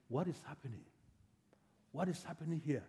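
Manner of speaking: soft, puzzled question; hushed asking. Acted. Blind man touching somebody sitting near him, hushed asking. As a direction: ask it quietly of the person nearby.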